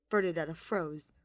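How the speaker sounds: ordinary delivery, nothing marked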